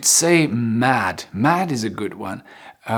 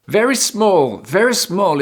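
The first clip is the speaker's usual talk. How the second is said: high announcement; 6 semitones higher than usual. Shown as a demonstration. Announcing new word.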